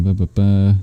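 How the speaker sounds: usual speaking voice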